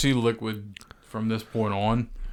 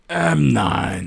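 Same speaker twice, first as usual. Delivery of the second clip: dim-witted Snake voice; 3 semitones higher, 6 dB louder than usual. Retold or imitated. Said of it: "He sounds like he has one brain cell".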